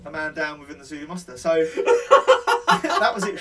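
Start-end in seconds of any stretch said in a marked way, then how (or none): 1.7-3.4 laughing through the words. Real, not acted. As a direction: laugh out the punchline.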